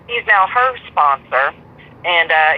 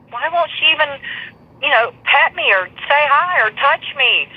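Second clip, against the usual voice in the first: higher, lightly voiced puzzled little dog; voicing the dog; 7 semitones higher than usual. Owner voicing Buffy asking why she's ignored, high and plaintive. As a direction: speak for the hurt, confused pet. Voicing her dog's confusion.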